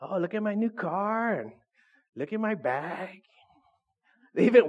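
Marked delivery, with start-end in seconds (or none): 0.0-3.2 soft, affected mock boasting; bragging. Preacher mimicking proud people showing off, airy bragging. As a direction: show off airily, drawing out the possessions. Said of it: "They're always bragging".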